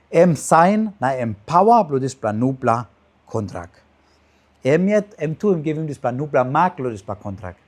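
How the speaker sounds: normal talking voice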